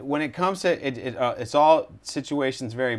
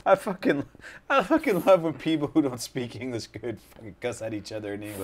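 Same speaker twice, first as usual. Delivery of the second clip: talking through laughter, pitched up at first; laughing. Real, not acted. Host reacting to a clip of broken-English cussing, laughing. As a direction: crack up while getting it out.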